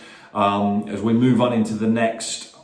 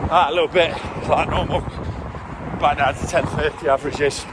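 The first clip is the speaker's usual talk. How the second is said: talking while jogging, breathing between phrases; while running; 5 semitones higher than usual. Real, not acted. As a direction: speak in short bursts mid-run. Filming mid-run, mile one done.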